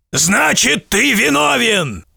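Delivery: high, slow wailing verdict; monster's howling verdict. A narrator's reading. Monster declaring the merchant guilty, high wailing shout. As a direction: wail the sentence out. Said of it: "заголосило чудище".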